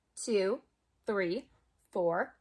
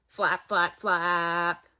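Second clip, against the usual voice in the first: low, sing-song flap flap, lightly voiced; flapping ducks; 9 semitones lower, much slower than usual. Showing kids how the ducks flap, low sing-song. Acting out duck wings.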